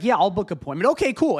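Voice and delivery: high, loud, eager rush; mock an overeager phone agent jumping in too fast; gushing, too-quick enthusiasm; over-enthusiastic bot. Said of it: "it's so much enthusiasm".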